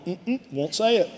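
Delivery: soft, closed-mouth mock grumble of a stubborn man; grumbling refusal. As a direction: hum a reluctant no like a stubborn husband. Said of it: "Some of you men are going".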